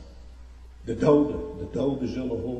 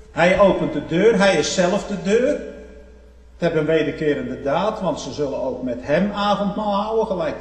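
full-force preaching; 5 semitones higher, 9 dB louder than usual. Acted. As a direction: proclaim it to the congregation, voice raised.

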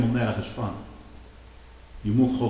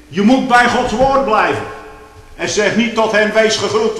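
loud, higher fervent pulpit preaching; preaching at full force; 8 semitones higher, 9 dB louder than usual. Acted. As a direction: lift the congregation with rising fervour. (luide, vurige preek)

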